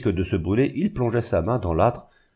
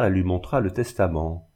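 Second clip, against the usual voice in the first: usual speaking voice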